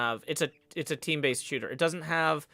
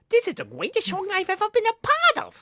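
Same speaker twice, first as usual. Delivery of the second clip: squeaky read; 5 dB louder than usual. Acted. Reads chat tip in high voice.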